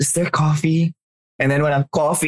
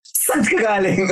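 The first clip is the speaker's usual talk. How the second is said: mock scolding mom; 7 semitones higher than usual.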